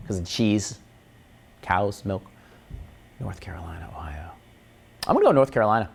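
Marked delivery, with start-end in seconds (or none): 0.1-4.3 much softer whispered weighing of options; unvoiced deliberating whisper. Real, not acted. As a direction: breathe both names to yourself. Musing over quiz answer.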